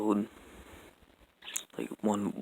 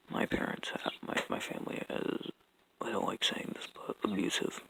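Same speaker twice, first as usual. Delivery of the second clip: quiet; 8 dB quieter than usual. Real, not acted. Confessing abuse at home.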